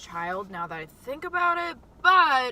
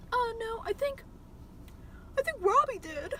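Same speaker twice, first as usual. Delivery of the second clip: put-on high, nervous little-kid voice; nervous lie; 12 semitones higher, 5 dB quieter than usual. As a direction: squeak out a guilty lie, much higher. Guilty kid lies to mom.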